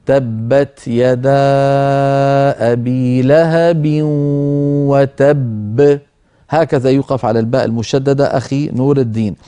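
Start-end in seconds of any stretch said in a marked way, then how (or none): 0.1-6.0 "وتب" very slow, the doubled b merged then bounced at the end; qalqala on the final baa. Shown as a demonstration. Sheikh shows the caller how to stop on "وتب", with a bouncing b. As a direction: stretch "تبت يدا أبي لهب وتب" slowly, stopping on a bouncing b in "وتب". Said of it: "أعيدها لك".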